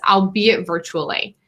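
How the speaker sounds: ordinary talk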